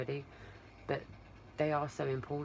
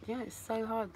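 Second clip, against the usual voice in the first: ordinary talk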